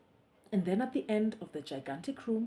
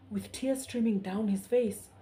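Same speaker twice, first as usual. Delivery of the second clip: usual speaking voice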